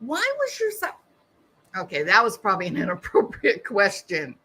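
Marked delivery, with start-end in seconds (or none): 0.0-0.9 shocked. Real, not acted. Shocked outburst.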